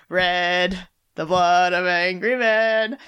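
slow, grand declaration of blood of angry men; dramatic proclamation. Pretend. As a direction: proclaim it slowly like a rallying cry. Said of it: "they all needed to hear that".

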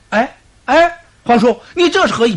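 high, loud, fast alarmed cry; panicked protest. Acted. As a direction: yelp in shock as they grab you. Generals protest being seized.

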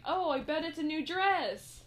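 high, loud and slow excited guess; mock delighted guess at a present. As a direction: gush out the guess hopefully. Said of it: "she's like".

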